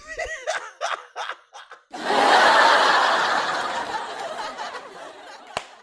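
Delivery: high, loud laugh that rolls on; helpless laughter; long cackling laugh. Real, not acted. Laughing hard to her viewers at the gossip.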